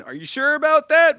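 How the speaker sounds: mock-disbelieving, way up high; Tim Robinson. Podcaster imitates a comedian doubting the fighter, high incredulous. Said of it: "pictured the Tim Robinson".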